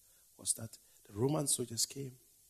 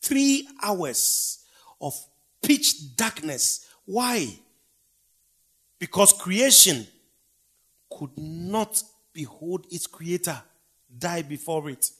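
loud, high, slow preacher's cry; preaching at full force; 7 semitones higher, 18 dB louder, much slower than usual. Acted. Preacher crying out the crucifixion darkness to the congregation, loud and slow. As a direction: thunder it out to the congregation.

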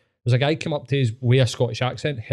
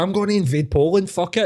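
higher, reckless mock ranting; hyped-up Hitler; 7 semitones higher than usual. Mocking Hitler bragging to his nation, high reckless rant. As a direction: play a cocky dictator hyped up at a party. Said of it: "he's sitting there going".

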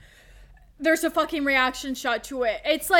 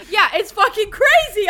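louder, higher burst of disbelief; exasperated outburst; 6 semitones higher, 8 dB louder than usual. Real, not acted. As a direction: throw up your hands, loud and high. Exasperated rant about the movie.

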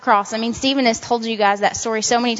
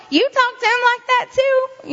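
defiant retort; 12 semitones higher than usual. Retold or imitated. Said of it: "I could have said".

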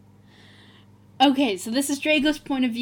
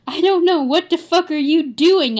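loud, high hammed-up retort; mock angry shout; 5 semitones higher, 5 dB louder than usual. A narrator's reading. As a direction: shout back in overplayed anger.

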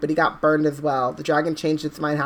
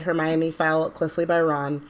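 ordinary delivery

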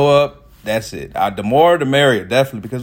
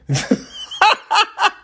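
loud high laugh; 3 dB louder than usual. Real, not acted. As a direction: crack up at yourself. Laugh at own joke.

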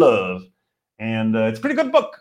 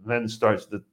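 ordinary talk

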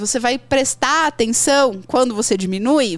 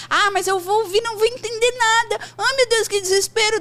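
high, whiny mock despair; mock panic; 10 semitones higher than usual. Imitating a student panicking at English without subtitles, high whiny despair. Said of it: "Dá um desespero ouvir".

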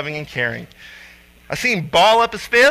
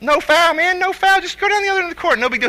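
breezy dismissal; 11 semitones higher than usual. Retold or imitated. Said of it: "And I'm like".

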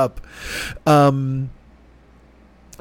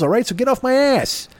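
mock defensive; 11 semitones higher than usual. Real, not acted. Mock-defensive outburst at listeners.

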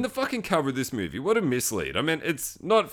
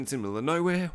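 ordinary talk

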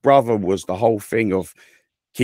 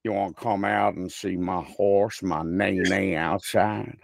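cowboy American; 5 dB quieter than usual. Shown as a demonstration. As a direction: lay on a lazy cowboy accent. Carries on the cowboy demo.

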